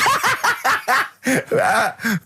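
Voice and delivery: high helpless cackle; shrieking laugh; burst of laughter. Real, not acted. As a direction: crack up laughing. [name] cracks up at Bottom.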